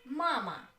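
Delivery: "Mama" with a loud first syllable; stress on first syllable. Shown as a demonstration. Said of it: "on the first syllable".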